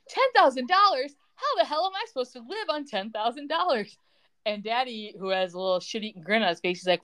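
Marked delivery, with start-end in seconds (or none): 0.1-4.0 high-pitched, incredulous indignation; mock outrage. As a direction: imitate an heiress appalled by the sum. Voices Brooke outraged at small payment.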